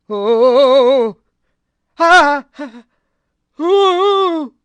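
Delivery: high, loud, rising anguished moans; nightmare moaning cries. A narrator's reading. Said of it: "It started as a low moan ... grew louder".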